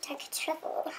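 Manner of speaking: very soft, breath-only whisper; hushed, savoring murmur; whispered shade name. Pretend. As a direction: whisper it like a secret. Naming the swatch in hushed tone.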